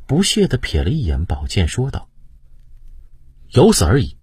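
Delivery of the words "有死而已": proud defiance. A narrator's reading. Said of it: "胤禔高傲地昂起头".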